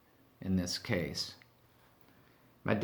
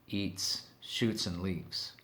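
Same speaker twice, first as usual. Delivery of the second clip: slow soft punchline repeat; 3 semitones lower, 8 dB quieter, much slower than usual. Acted.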